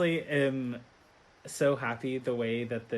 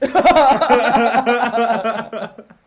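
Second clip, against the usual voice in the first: real high, loud burst of laughter; 9 semitones higher, 14 dB louder than usual. Real, not acted. Podcast host cracking up over the fatal slap joke, loud laughing. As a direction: crack up loudly and let it run on.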